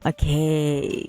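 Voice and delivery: normal talking voice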